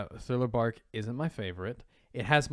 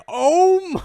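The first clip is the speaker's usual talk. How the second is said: much higher, louder, squeaky "oh my"; scandalized fan reaction, pitched way up; mock shocked gasp; 13 dB louder than usual. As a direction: shriek it in scandalized surprise. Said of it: "I was like".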